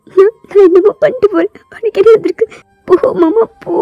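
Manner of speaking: high, crying go away; hurt girl sending her cousin away; tearful dismissal. Acted. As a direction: shoo him off through sobs. Girl sends him away crying.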